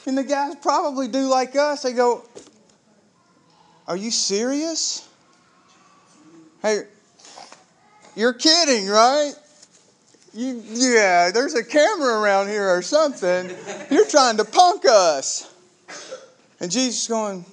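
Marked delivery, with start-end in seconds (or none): 3.9-15.5 high, louder, can't-believe-it tone; incredulous disbelief. Acted. The two disciples scoffing at the stranger on the road, high and incredulous. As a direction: scoff like you're being pranked.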